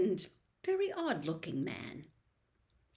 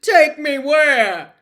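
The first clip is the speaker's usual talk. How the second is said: slow, terrified shout, much higher and louder; trembling; 6 semitones higher, 17 dB louder, slower than usual. Acted. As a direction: dread breaking through Hagrid. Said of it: "who was trembling".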